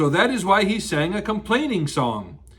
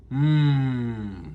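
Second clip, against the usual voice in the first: thinking hum; 9 semitones lower than usual. Acted. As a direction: hum like a pondering bear. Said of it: "Winnie the Pooh was thinking like this".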